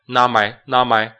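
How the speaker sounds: "not my" with the t dropped; silent t in not. Shown as a demonstration. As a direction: say "not" with no t before "my". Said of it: "然后not的t不读". ("not"的t不发音)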